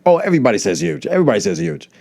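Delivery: high, fast put-on voice of a dismissive guy.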